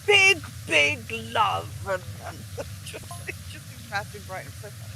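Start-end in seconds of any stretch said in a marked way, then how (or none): 0.1-1.7 loud, slow, booming "Big, big love"; reading the autograph. Retold or imitated. Guest reads out Brian Blessed's big autograph, slow and booming. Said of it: "the photo, which was like".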